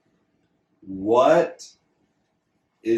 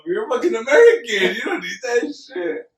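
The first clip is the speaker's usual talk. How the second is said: high, loud laughing yell at little brother; exasperated shout; 10 semitones higher, 6 dB louder than usual. Retold or imitated. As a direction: shout it at him, half laughing. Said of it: "We're like".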